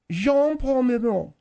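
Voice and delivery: "Belmondo" the French way, consonants melted into nasal vowels; French pronunciation. Shown as a demonstration. Co-host insisting on her version to doubters, French pronunciation. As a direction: pronounce "Jean-Paul Belmondo" as in French. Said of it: "The French pronunciation".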